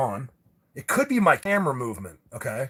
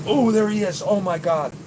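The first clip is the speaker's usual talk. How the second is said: high, loud burst of amazement; excited discovery; 6 semitones higher, 5 dB louder than usual. Real, not acted. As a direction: exclaim as you suddenly spot it. Spots the object on video.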